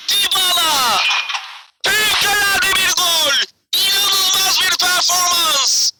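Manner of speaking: high excited goal shout; screaming football commentator. Acted. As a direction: yell it like a stunned commentator. Commentator calls a great goal.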